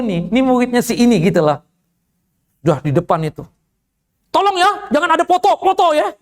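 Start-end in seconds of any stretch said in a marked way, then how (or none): 4.3-6.1 high-pitched mock yell; imitated shouting. Woman warning others not to take photos, mock shouting. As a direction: call out a no-photo order in a raised, high voice. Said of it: "kenapa ibu yang teriak-teriak?".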